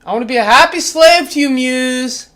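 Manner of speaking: ordinary delivery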